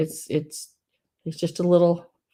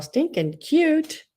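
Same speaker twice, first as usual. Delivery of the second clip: much higher, slow, stretched; drawn-out gush; 11 semitones higher, much slower than usual. Real, not acted. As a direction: stretch it out in delight. Gushing over figurines.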